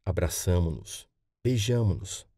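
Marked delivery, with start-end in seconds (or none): none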